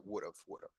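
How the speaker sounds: "would have" softly run together as "would'a"; American reduced would have. Shown as a demonstration. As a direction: quietly model "would have" reduced, like "wuda". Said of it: "that was very good". ("would have" contraído, en voz baja)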